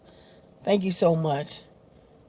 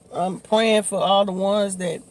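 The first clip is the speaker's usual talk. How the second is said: ordinary talk, nothing marked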